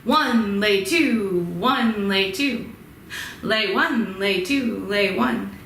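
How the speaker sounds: one and two stressed, lay light; swinging count; six eight count. Shown as a demonstration. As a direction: count a lilting compound meter. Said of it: "sounds like I just went into six eight".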